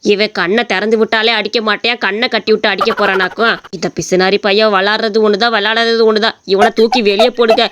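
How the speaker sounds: old father mocking the would-be son-in-law; scornful old father. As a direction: sneer at the useless son-in-law.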